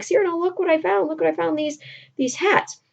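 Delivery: high, quick, excited showing-off; excited cadet. Retold or imitated. Cadet showing Cyrano the hats he found, excited.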